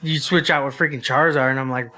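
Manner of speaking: usual speaking voice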